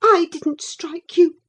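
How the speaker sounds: put-on high, loud, defensive girl; girlish, wounded denial; upset girl. A narrator's reading. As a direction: protest while holding back tears. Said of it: "muttered Cathy, chewing her lip".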